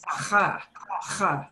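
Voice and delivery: "Huh" rasped from down in the throat; guttural "huh", friction at the back of the mouth; throaty Spanish J. Shown as a demonstration. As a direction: say "huh" twice as a scraped Spanish J. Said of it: "It should come from down there".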